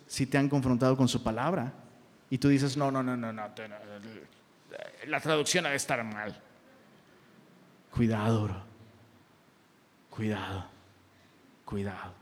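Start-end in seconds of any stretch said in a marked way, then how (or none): 2.8-6.4 low mumbled excuse, acted out; mock dismissive denial. Pastor mimicking someone blaming the translation, mumbling denial. 7.9-12.1 low, soft caution with long pauses; quiet warning. Real, not acted. Pastor warning a brother against denying the Word, hushed caution. Warns after the mocked excuse.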